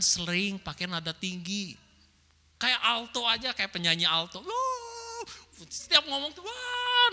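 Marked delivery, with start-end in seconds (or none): 4.4-6.4 high held exclamation. Shown as a demonstration. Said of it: "nadanya tuh tinggi banget". 6.4-7.1 long high-pitched exclamation. Shown as a demonstration. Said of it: "nadanya tuh tinggi banget".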